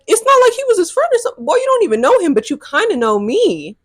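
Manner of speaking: rapid, high-pitched indignant protest; indignant reply. Retold or imitated. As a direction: fire it off high and fast, incredulous. Retelling her indignant reply to commenter.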